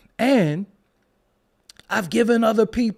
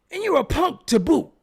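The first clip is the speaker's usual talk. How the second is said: mocking quote; 9 semitones higher, 5 dB louder than usual. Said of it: "said to somebody".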